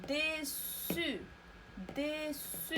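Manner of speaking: "ديسو" very slow, two held syllables with a gap; model pronunciation. Shown as a demonstration. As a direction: draw out dé, then su with a clear s in "ديسو". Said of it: "إذا غادي نقراوها". (نطق "ديسو" مقطّعًا ببطء شديد)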